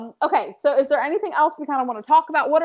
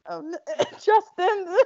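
imitating crying; 7 semitones higher, much slower than usual. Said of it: "whenever I cry while I read".

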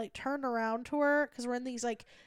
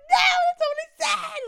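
high protesting coworker; 7 dB louder than usual. Retold or imitated. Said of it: "just like".

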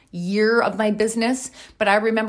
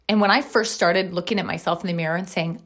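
ordinary delivery

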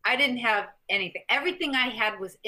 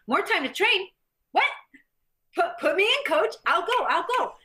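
high, louder burst of mock eagerness; eager excitement; 9 semitones higher, 3 dB louder than usual. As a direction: jump at the chance like an eager kid. Retells eager reply to director.

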